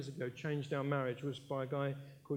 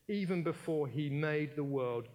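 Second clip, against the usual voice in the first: usual speaking voice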